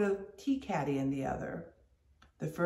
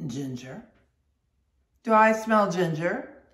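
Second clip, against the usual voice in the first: suspicious sniffing question; a hint of suspicion in the sailor's voice; 6 dB louder than usual. A narrator's reading. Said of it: "suspiciously asked Stubb".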